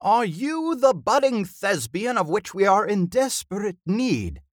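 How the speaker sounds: high, loud, grand declamation; theatrical old thespian. A narrator's reading. Old actor questions budding helper, grandly theatrical. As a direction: declaim as if to the back row.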